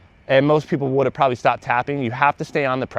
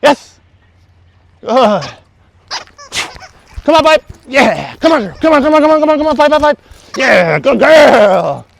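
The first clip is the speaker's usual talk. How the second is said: high excited praise; 11 semitones higher, 8 dB louder than usual. Real, not acted. Trainer gushing and coaxing dog, very high and loud. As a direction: gush over the dog and urge her in. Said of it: "You're so animated".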